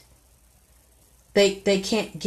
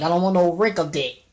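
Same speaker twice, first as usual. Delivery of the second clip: normal talking voice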